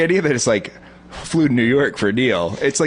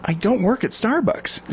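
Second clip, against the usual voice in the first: slow, slightly higher flat reply; deadpan denial; 10 semitones higher, slower than usual. Retold or imitated. Correcting the barista, slow deadpan reply. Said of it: "and I was like".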